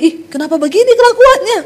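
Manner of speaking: quick, high-pitched dismayed outburst; imitated newlywed's appalled squeal; mock shock. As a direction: blurt it out high in disbelief. Imitates shocked newlywed at spouse's behaviour.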